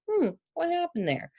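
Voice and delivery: high-pitched, musing mock puzzlement; puzzled wondering. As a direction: act out wondering what went wrong.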